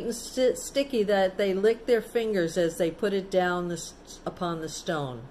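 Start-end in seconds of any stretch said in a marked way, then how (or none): none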